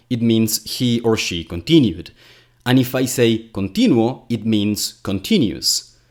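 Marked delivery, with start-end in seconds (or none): 3.5-4.2 "continuo" stressed on the syllable ti. Shown as a demonstration. Showing the adjective, stress on TI in "continuo". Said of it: "changing the stress in a word".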